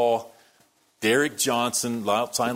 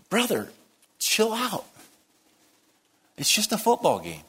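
high, lightly voiced friend telling him to relax; James calming him; 12 semitones higher than usual. James telling the furious preacher to relax, lightly mimicked. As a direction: mimic a friend saying calm down. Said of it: "he says".

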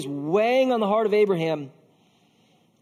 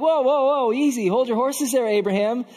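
higher, louder "whoa" easing off; taken-aback reproof; 5 semitones higher, 4 dB louder than usual. Acted. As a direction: rein someone in, mildly shocked. Imagined listeners check Abraham.

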